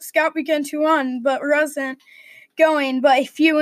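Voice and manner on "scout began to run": ordinary delivery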